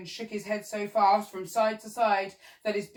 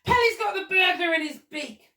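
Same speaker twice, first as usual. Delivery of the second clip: high, loud and thrilled; excited cry; 10 semitones higher, 7 dB louder than usual. Acted. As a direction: shout the good news. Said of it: "cried the monkey".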